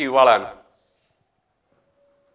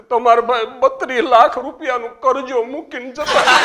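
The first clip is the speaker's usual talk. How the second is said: higher, louder weeping widow; mock wailing widow; 8 semitones higher, 6 dB louder than usual. Widow crying to the president about the debt, loud mock wail. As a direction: wail it out in hammed-up grief. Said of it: "તો રોતા રોતા મને કે".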